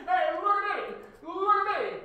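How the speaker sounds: high, loud taunting mimicry; pitched-way-up mocking call; mocking imitation. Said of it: "That's what you do".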